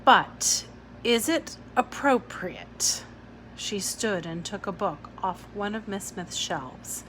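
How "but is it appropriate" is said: slow, doubtful question; slowly. Acted. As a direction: draw it out slowly. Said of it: "the teacher said slowly".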